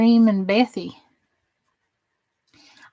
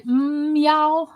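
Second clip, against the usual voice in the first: a drawn-out Southern moan, mm y'all; half-joking moan with a Southern drawl; 5 semitones higher than usual. Pretend. Streamer asked how to moan in Southern, does a mock Southern moan. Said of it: "how do you moan in Southern?".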